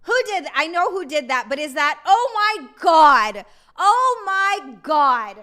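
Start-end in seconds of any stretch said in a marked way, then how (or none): 2.1-5.4 slow, loud drawn-out gasp of disbelief; real overwhelmed joy, slow and loud; stunned excitement. Real, not acted. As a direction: stretch it out in real amazement. Reacting to a big donation.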